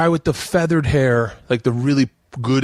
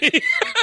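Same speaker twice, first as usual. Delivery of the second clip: squealing laugh; high burst of laughter. Real, not acted. Host cracking up at the boy's reply, high squealing laugh.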